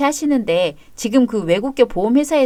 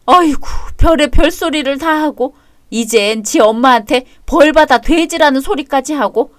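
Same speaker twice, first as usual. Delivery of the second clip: wailing lament; 3 semitones higher, 4 dB louder than usual. Acted. Mother wails against her sons.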